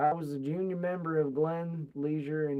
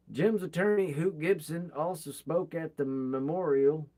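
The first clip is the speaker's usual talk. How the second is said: ordinary delivery, nothing marked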